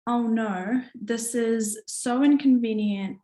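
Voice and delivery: ordinary talk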